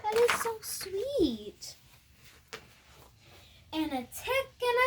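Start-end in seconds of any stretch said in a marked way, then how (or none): 0.0-1.8 delighted. Real, not acted. Admiring child's gingerbread house.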